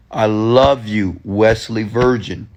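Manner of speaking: slow, drawn-out declaration of love; emphatic self-affirmation. Shown as a demonstration. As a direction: stretch the words out as you affirm yourself. Emphatic demonstration of loving himself.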